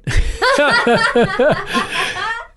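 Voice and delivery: high, long burst of laughter; hearty laugh. Real, not acted. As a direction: crack up at your own story. Laughs at own story.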